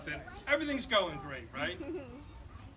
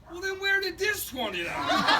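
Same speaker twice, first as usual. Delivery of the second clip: put-on kid voice; 11 semitones higher, 7 dB louder than usual. Son's innocent punchline question.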